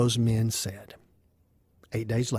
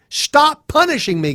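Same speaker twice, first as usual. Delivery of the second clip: high, raised plea; desperate protest; 11 semitones higher, 4 dB louder than usual. Acted. Job begging God to stop, desperate high outcry. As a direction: cry out against the punishment.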